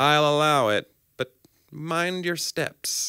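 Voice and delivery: high, drawn-out mock warning; TV judge. Judge allowing it with a warning, mock-stern. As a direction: rule grandly, slow right down to caution. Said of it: "there's always a judge that says".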